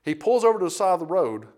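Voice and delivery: usual speaking voice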